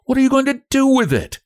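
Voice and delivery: fast, high-pitched sharp demand; sharp question. A narrator's reading. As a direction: ask it sharply and quickly. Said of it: "asked Frances sharply".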